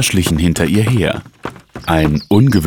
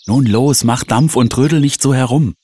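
higher, brisk-spirited prodding; urging; 7 semitones higher than usual. A narrator's reading. Sister to dawdling brother, urging him on. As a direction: chivvy him along. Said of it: "forderte sie ihn auf".

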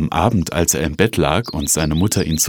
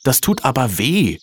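higher-pitched, lightly acted child's protest; pained complaint; 7 semitones higher than usual. A narrator's reading. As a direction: complain of the hurt without really shouting. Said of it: "schrie Stefan". (wehleidiger, höherer Protest eines Jungen)

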